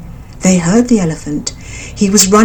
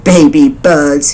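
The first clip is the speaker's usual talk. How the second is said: slow, lower elephant voice, scornful; scoffing elephant; 4 semitones lower, 4 dB louder, slower than usual. A narrator's reading. Elephant scoffing at the mother bird, slow and low. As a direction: sneer at something so small.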